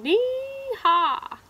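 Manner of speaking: high, two-note siren wail; police siren imitation. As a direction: wail nee-naw like a police car.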